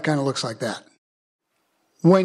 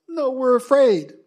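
high, slow acted reluctance; mock fearful refusal; 7 semitones higher than usual. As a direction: balk like the scared crowd, lightly hammed-up. Voicing the reluctant, fearful people.

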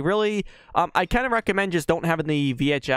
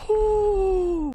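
relieved high whew. Real, not acted. Relief after escaping.

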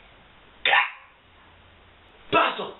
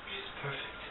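whisper; 18 dB quieter than usual. Acted. Dorian lovestruck over Sybil.

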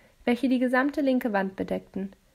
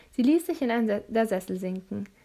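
ordinary delivery

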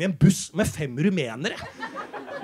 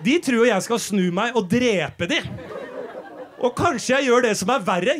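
ordinary talk, nothing marked

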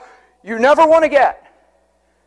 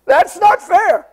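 mock protest; 7 semitones higher, slower than usual. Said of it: "preacher mocking the complaint".

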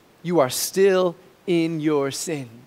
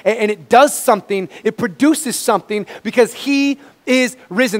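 higher and louder triumphant proclamation; preaching at full force; 7 semitones higher, 8 dB louder than usual. Acted. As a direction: shout the good news to the church. Preaching climax.

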